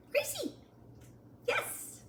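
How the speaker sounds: high-pitched call to the puppy; high name call. Real, not acted.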